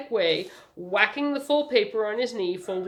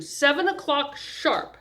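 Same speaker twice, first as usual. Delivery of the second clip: slow, high, emphatic order; insistent socialite's statement; firm insistence; 8 semitones higher, 4 dB louder, slower than usual. A narrator's reading. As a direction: set the time as settled, no argument. She lays down the hour.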